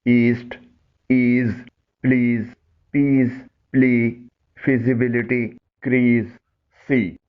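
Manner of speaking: teacher stressing each ee word as a model; careful pronunciation demo. Shown as a demonstration. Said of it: "ان الفاظ کی sound سنیں".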